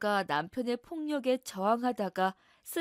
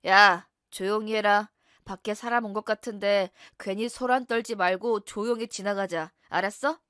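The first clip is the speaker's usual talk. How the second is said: menacing husband; 6 dB louder than usual. Acted. Husband hushes wife, police outside.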